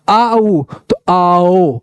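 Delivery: very slow glide from "आ" to "उ"; drawn-out vowels. Shown as a demonstration. Teacher urging students to say it and see, drawn out. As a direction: stretch "आओ" so the vowels melt together. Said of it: "बोल के देखो".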